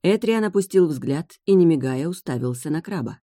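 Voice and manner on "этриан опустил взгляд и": usual speaking voice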